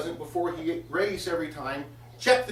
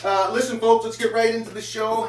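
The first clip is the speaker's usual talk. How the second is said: announcer; 2 semitones higher, 6 dB louder than usual. Acted. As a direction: hype the crowd for the DJ. Announces show and DJ.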